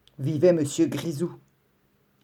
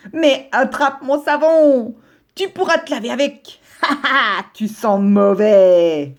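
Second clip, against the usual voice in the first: mocking taunt; 5 semitones higher, 5 dB louder, slower than usual. Grisou mocks his neighbour.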